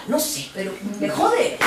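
ordinary talk, nothing marked

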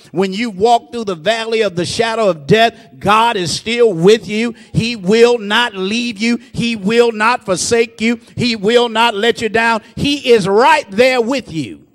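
loud, slow building sermon cry; preaching. Acted. Preacher assuring the congregation God stays with them, loud and rising. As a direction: hammer each promise home.

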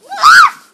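high ticklish scream; tickled shriek. Real, not acted. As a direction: shriek while being tickled. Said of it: "Don't tickle me".

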